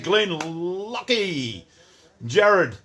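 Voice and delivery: high, long-stretched name call; drawn-out greeting. Real, not acted. Streamer hailing viewers as they join, drawn-out call. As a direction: stretch the name right out as you hail them.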